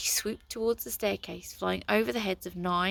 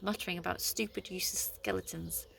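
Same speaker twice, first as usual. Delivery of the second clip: ordinary delivery, nothing marked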